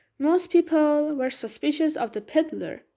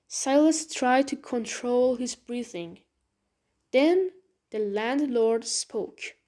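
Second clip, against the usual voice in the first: usual speaking voice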